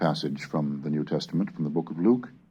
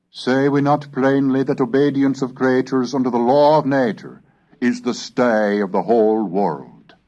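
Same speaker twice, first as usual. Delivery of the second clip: put-on raised, measured oratorical reading of old prose; formal reading; 9 semitones higher, 6 dB louder than usual. Lecturer reading Hooker's prose to the class, in an oratorical voice. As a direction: declaim it like a Tudor sermon.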